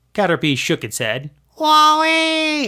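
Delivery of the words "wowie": very high, loud cartoon worm; a tiny bug cheering; squeaky creature cry. A narrator's reading. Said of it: "it cried".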